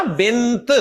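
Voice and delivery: بنت stopped on a released T; T when pausing. Shown as a demonstration. Teacher explaining pausing on a word, بنت with final T. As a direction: stop on the T at the end of بنت. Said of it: "کیونکہ آپ رک رہے ہیں نا".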